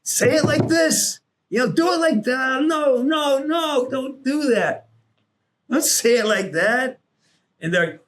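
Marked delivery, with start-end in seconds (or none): 0.0-7.0 retold frantic coach, pitched way up; exasperated coaching. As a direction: holler corrections in an exaggerated high voice. Acts out coaching the replacement actor.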